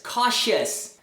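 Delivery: "cautious" with a short -us ending; two-syllable "cautious" ending in "shuhs"; correct pronunciation. Shown as a demonstration. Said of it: "É famous".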